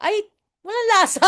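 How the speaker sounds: put-on high, laughing old lady complaint; mock the grandma's complaint; a hammed-up old woman grumbling; mimicking nanay. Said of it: "Sabi niya".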